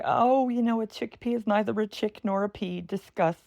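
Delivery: put-on high, lilting old grandma voice. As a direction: muse like a quirky grandma. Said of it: "my grandma voice".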